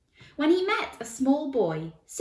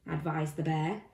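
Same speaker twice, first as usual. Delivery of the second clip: plain reading voice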